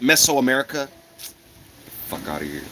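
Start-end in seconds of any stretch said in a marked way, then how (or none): 2.1-2.6 low, much softer under-the-breath scoff; muttered dismissal. Real, not acted. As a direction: mutter it away to yourself. Scoffing at a claim he rejects.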